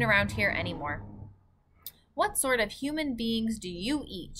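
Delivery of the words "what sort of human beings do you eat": ordinary delivery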